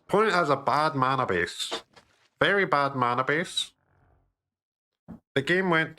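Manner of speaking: smug nerd pointing out a flaw; a hint of know-it-all nerd. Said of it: "the nerd voice".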